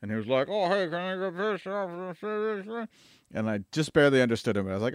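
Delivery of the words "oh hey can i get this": slow, muffled, higher voice; muffled through mask. Retold or imitated. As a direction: talk through a face mask. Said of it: "his voice was muffled".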